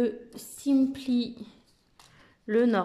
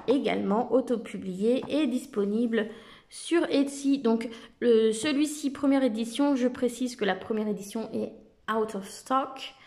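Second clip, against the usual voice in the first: ordinary talk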